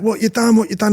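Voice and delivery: high, flapping mock panic; like headless chickens, all in a flap; imitated frantic questioning; panicking bosses. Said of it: "running around like headless chickens".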